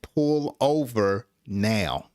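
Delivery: ordinary delivery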